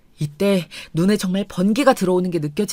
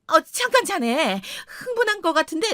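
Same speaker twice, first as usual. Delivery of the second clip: father-in-law's voice; 7 semitones higher than usual. Retold or imitated.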